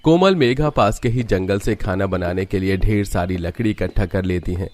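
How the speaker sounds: ordinary delivery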